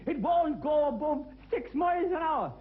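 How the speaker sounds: high, loud, slow mock grumble; hammed-up daft uncle; Uncle Paddy complaining. Uncle Paddy complains to the salesman, high and slow. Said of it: "he said".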